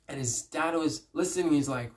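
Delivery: ordinary talk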